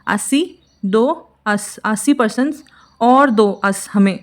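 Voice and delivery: slow, drawn out, each piece apart; slowed-down memory trick; slow mnemonic. Shown as a demonstration. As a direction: spell the trick out slowly for students. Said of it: "mnemonic spelled out slowly".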